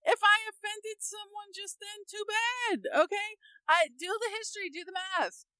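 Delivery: high, defiant "too bad" to anyone offended. Real, not acted. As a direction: toss it off with a shrug in the voice. Defiant aside to offended listeners.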